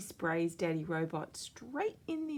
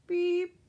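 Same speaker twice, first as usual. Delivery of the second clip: high, loud mechanical beep; one sharp high robot beep; 14 semitones higher, 8 dB louder than usual. A narrator's reading. Robot beeps.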